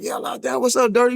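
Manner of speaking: put-on high, lively hail to an old friend; excited greeting. Old friend greeting him in lockdown, high and excited. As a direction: pitch it up like you're greeting your boy. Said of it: "He like".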